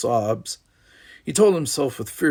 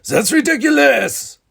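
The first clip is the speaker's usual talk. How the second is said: much higher, louder furious bellow; angry bellow; 10 dB louder than usual. Acted. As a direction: explode at his son. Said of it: "Maurice bellowed angrily".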